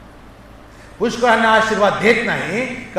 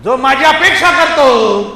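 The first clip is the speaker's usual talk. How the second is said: loud, high preacher's cry building to a shout; rising sermon fervour; forceful preaching; 10 semitones higher, 9 dB louder than usual. Real, not acted. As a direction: proclaim it to the whole congregation.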